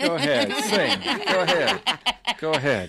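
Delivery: laughing while urging. Real, not acted. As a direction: giggle through the words. Urging her to sing, laughing.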